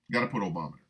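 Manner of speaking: ordinary talk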